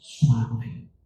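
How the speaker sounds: gentle, slow hush on the last word; soft voice. Shown as a demonstration. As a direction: drop low and very soft. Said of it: "Deus deu uma voz suave".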